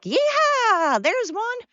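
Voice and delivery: high, held-out rodeo holler; playful high-pitched cowgirl cry; cowboy whoop. Pretend. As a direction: whoop it like you're lassoing. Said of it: "and be like".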